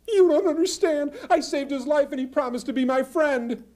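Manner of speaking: high, insistent squeaky plea; begging the wise goat to listen; pleading squirrel. Acted.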